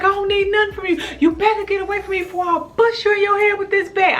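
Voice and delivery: high, fast screaming threat; crazy threat. Retold or imitated. Woman threatening the rich driver with a bat, high and frantic. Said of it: "I was crazy".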